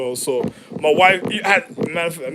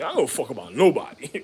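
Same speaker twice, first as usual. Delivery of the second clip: ordinary talk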